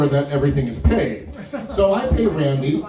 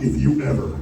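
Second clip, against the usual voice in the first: menacing threat; 10 semitones lower than usual. Retold or imitated. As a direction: growl it close into his ear. Pull him in real close.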